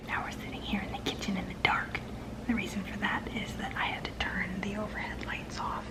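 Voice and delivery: very soft, hushed whisper. Real, not acted. Vlogger explaining in the dark kitchen, whispering. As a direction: whisper as if the dark room demands it. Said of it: "I don't know why I'm whispering".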